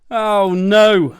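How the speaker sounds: high, loud wail of dismay; loud acted despair; exasperated bear. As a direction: wail it out, sleepless and fed up. Mister Bear kept awake by clock.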